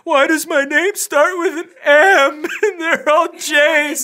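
hammed-up wailing teen, high and loud; teenage angst. Daughter lamenting her odd initial, mock teenage angst. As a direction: wail it in mock anguish, drawn out. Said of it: "teenage angst going".

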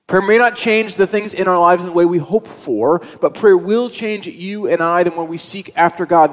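building sermon intensity; full preaching. Acted. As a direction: drive the point home to the congregation.

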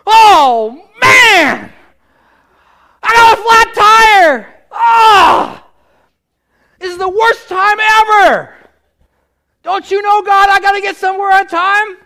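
high, very loud hammed-up wail over a flat tire; mock panicked yelling. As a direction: ham up the despair, shouting to the sky. Sermon acts out flat-tire panic.

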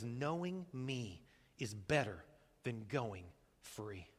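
low, soft and slow; quiet, measured word from God; calm divine answer. Acted. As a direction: state it gently, with weighty pauses. God's answer to the Israelites.

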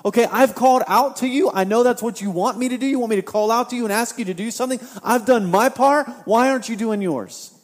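indignant complaint. Acted. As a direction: demand God do his part. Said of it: "Habakkuk could be saying".

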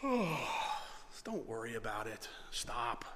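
low, soft and very slow; calm. Acted. Prophet to his panicking servant, calm. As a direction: settle him down, unruffled. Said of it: "Elisha calmly responds".

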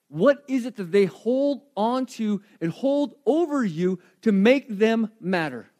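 ordinary talk, nothing marked

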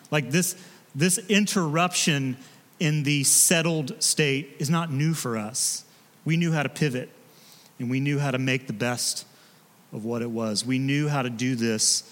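normal talking voice

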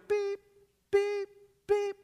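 high, even electronic beeps; short high-pitched tones, evenly spaced; imitated countdown beeps. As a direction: beep like a ticking TV clock. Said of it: "at the end it would go".

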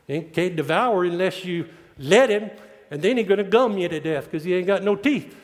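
usual speaking voice

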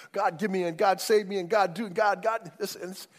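mock-pleading each request, rising; hammed-up greedy prayer; whiny demanding. Preacher mimics people demanding from God, pleading.

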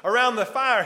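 usual speaking voice

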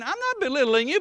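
loud, high, shouting preacher at the pulpit; full-force preaching. Real, not acted. Preacher scolding the congregation, in a loud shouting preach. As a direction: hammer it home like a fired-up revival preacher.

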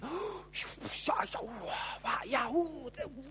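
a sharp, shouted gasp of wonder; acted crowd gasp; amazed gasp.

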